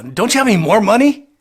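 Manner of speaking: mock the crooked cop's annoyed demand; upset cop. Cop upset over only twenty dollars, loud and indignant. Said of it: "He got very upset".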